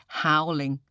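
ordinary delivery, nothing marked

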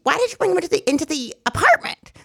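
high, scolding mom voice; hammed-up mother grilling her daughter; mock mom. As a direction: mom asks Emma about the guest, voice pitched high. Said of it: "and she'd be like".

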